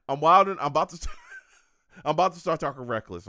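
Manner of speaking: loud, laughing self-warning; laughing at himself. Real, not acted. Streamer catching himself getting wild, loud and laughing. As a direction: crack up while reining himself in.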